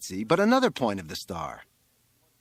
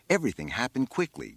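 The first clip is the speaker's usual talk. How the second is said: ordinary delivery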